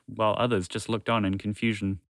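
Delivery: plain reading voice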